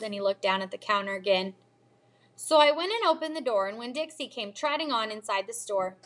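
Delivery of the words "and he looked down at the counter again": ordinary delivery, nothing marked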